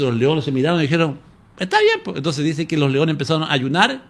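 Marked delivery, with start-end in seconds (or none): 1.6-2.2 squeaky high little lion voice; lion's reply. Retold or imitated. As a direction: answer like a meek lion. The lions answer Daniel.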